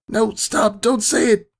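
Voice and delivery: high, loud desperate cry; screamed plea. Retold or imitated. Narrator screaming at her past self to stop. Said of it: "I screamed".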